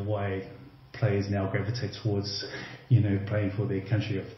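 ordinary talk, nothing marked

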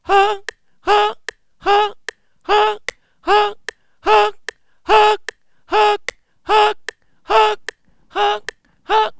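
steady high falsetto notes between clicks. Shown as a demonstration. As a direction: flip into head voice on each offbeat. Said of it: "今度は裏声でもね".

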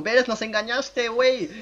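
high-pitched mock accusation; complaining viewer. Acting out tricked viewers accusing him, mock indignation. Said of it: "Y luego".

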